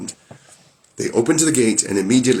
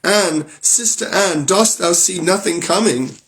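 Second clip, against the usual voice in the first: high, slow, drawn-out call to her sister; frightened wife calling up to sister Anne; anxious call; 9 semitones higher than usual. Acted. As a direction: call up the tower, hoping. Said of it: "she cried".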